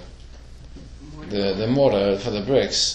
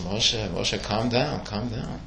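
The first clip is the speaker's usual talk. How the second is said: calm Moshe down gently, quieter and quieter; soothing God; 2 semitones lower, 7 dB quieter, slower than usual. Retold or imitated. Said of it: "So God says".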